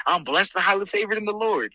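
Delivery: lifted mock-churchy recital; imitating a churchgoer's stock blessing; churchy cliché. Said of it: "telling everybody".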